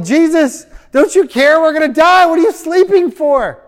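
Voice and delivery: high, loud panicked cry; frantic, pleading shout for help; freaking out. Acted. Disciples waking sleeping Jesus in the storm, panicked. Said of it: "the disciples are freaking out".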